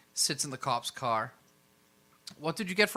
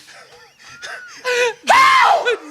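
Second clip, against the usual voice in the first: high crying laughter; shrieking laugh; 15 dB louder than usual. Real, not acted. Hysterical laughter after failed roll.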